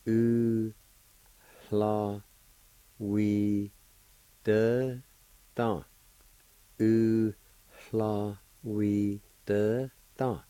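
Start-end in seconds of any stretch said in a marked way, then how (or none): 0.1-5.8 U, la, wi, de, dan each set apart with pauses; teacher sounding out each syllable; separated syllables. Shown as a demonstration. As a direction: break the word into syllables. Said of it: "it's pronounced like this".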